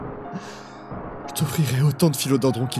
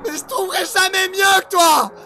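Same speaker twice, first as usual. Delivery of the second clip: desperate shout; 7 dB louder than usual. Acted. Grieving lover cries out.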